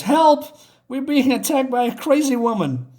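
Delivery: high, loud mock alarm; retold panicked yell; acted cry for help. Girl cries for help.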